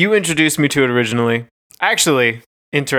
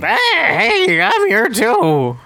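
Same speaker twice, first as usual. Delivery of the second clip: high, slow little goblin voice; 10 semitones higher, much slower than usual. Pretend. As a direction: squeak it out like a tiny goblin. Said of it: "My Goblin voice".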